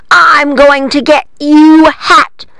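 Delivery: hammed-up threat to her hat; mock witch roar. A narrator's reading. Witch roars at the cats, slow and hammed-up. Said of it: "The witch was roaring".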